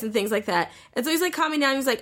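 normal talking voice

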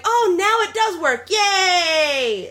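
high, delighted cheer; sudden joy when it works; excited. Retold or imitated. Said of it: "I'm like".

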